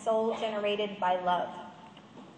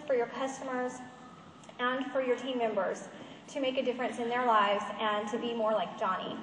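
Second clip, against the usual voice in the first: usual speaking voice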